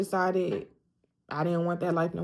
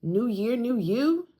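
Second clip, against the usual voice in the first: light sing-song mockery of others, higher at the end; mock cheer; 5 semitones higher, slower than usual. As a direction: tease the cliché, rising on you. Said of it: "everybody's like".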